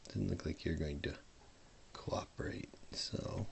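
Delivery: whispered mumble to self; mumbling. Real, not acted. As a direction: mutter it under your breath. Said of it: "I start to mumble".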